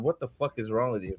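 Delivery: low, soft mock dismay; a hint of a despairing dad, muttered low; upset father. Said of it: "Tweedledee and Tweedledum's upset father going".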